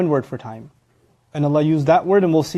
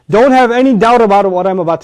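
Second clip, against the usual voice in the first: loud, raised insistence; emphatic warning; 5 semitones higher, 6 dB louder than usual. Acted. Speaker heads off the listeners' doubt, loud and emphatic. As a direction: insist forcefully, brooking no doubt.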